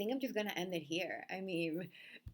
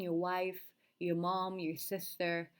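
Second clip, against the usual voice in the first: normal talking voice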